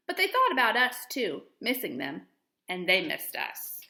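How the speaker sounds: gentle storybook reading voice; expressive picture-book read-aloud; reading to children. Acted. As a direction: read it like story time for young kids. Teacher reads picture book aloud.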